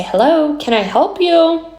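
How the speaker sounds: bright, high customer-service hello; sing-song politeness to a stranger; polite doorbell greeting. Retold or imitated. Polite greeting through doorbell camera.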